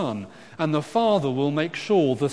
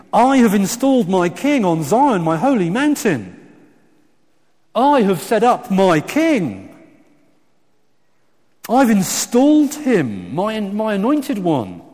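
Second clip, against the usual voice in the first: loud, high, forceful proclamation; very emphatic; 7 semitones higher, 9 dB louder than usual. Acted. God terrifying the rebels by naming his king, very emphatic. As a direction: declare it with full kingly authority. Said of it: "It's actually very emphatic".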